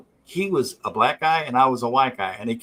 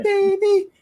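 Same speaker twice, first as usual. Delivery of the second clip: nerdy pimp voice; 9 semitones higher than usual. Retold or imitated. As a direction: play the creepy nerd cooing at a stripper, way up high.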